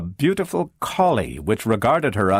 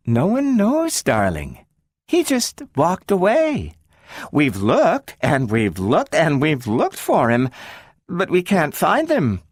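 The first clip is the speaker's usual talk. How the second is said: higher soothing mom, a touch strained; mother distracting daughter with the dog; consoling mother; 8 semitones higher than usual. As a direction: comfort while hiding the truth.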